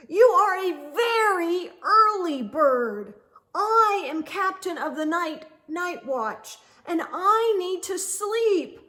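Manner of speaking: loud, high, fed-up outburst; exasperated owl. Acted. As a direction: snap at the pest to go away. Tired Owl finally snaps.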